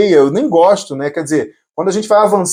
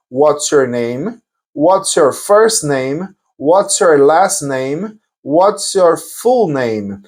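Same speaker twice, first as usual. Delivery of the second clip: slow, measured language-teacher delivery; slow model questions; 3 semitones lower, much slower than usual. Shown as a demonstration. Teacher showing variants of an interview question, slowly. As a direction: say each question slowly for beginners.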